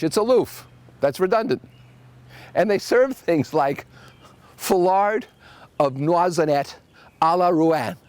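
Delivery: ordinary talk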